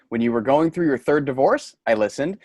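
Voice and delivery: ordinary talk, nothing marked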